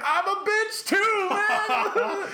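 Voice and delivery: mock whine. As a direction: wail it like a big softie, cracking up. Admits to girlfriend he's soft.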